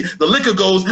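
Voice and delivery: usual speaking voice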